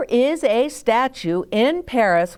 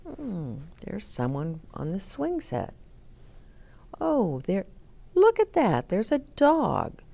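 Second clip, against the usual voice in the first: musing note-taking; 6 dB quieter than usual. Pretend. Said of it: "you might even take notes".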